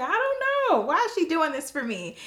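high-pitched puzzled protest, starting very high; bewildered doubt. Retold or imitated. Student asking another mentor about heart mom, bewildered doubt.

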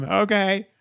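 ordinary talk, nothing marked

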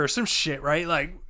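ordinary talk, nothing marked

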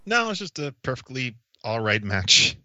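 ordinary delivery

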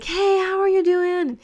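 high, slow, bubbly hello; excited greeting. Retold or imitated. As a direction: greet them like an overjoyed tour guide. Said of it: "He was super excited".

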